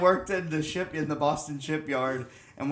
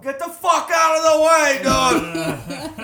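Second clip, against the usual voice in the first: high, loud shouted insults through a laugh; rowdy Boston trucker yelling to clear the road; cigar-chomping truck driver; 11 semitones higher, 10 dB louder, slower than usual. Retold or imitated. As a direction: bellow at someone blocking the way. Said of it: "he'd go".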